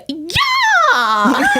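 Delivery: high, stretched-out "yeah" yell; mock Vine yell. Quoting kid smacked with basketball, high mock yell. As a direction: imitate the kid's long high cry. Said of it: "he's just like".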